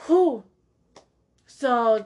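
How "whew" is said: high, loud whew; big exhaled whew to let off steam. Real, not acted. Letting out tension after rant.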